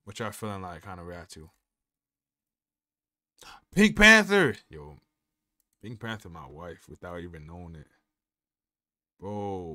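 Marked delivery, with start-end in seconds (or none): 3.7-4.6 loud, high burst of excitement; excited shout. Real, not acted. Asking the chat what to react to, shouting. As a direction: yell the name out. Suddenly calls out a request.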